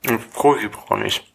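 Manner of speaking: mumbled through food; chewing while speaking; mouth full. Real, not acted. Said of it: "Ich versteh dich".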